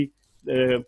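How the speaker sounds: normal talking voice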